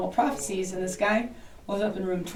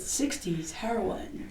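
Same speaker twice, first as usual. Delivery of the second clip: quiet ghost answer; 11 dB quieter, much slower than usual. Retold or imitated. Ghost answer via spirit box.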